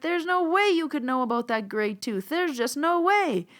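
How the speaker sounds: imitating a thrilled woman; excited disbelief. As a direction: stretch out the amazed no way. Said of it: "the most excited person I've ever delivered a message".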